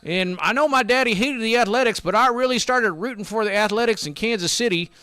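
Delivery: voiced old railroad man. Grandfather's son voiced in a story.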